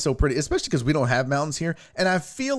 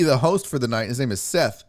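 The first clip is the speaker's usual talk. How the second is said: ordinary delivery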